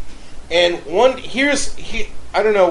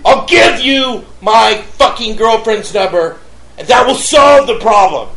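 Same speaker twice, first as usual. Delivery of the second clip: loud, high-pitched harangue; re-enacted shouted tirade; furious rant; 4 semitones higher, 5 dB louder than usual. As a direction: keep berating the neighbor, hammering the swear words. Acted quarrel with the neighbor.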